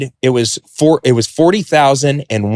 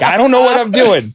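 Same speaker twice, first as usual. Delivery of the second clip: high, louder helpless wail; panicked whine; 12 semitones higher, 4 dB louder than usual. Retold or imitated. Confessing to his furious dad, panicked whine. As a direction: plead like a caught kid.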